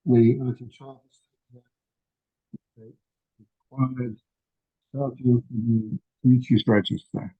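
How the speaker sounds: muffled, speaking while looking down away from the mic. Real, not acted. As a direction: sound muffled as if turned away from the microphone. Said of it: "you were a little bit muffled then again".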